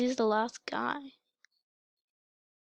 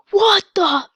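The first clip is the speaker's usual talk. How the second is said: loud, higher cry of disbelief; startled exclamation; 8 semitones higher, 12 dB louder than usual. Real, not acted. Gamer reacting to the game, startled shout. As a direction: burst out louder and higher.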